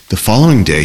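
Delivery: ordinary delivery, nothing marked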